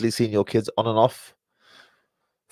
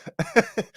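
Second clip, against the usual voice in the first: helpless laugh; 8 semitones higher than usual. Real, not acted. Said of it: "Don't know why I'm laughing".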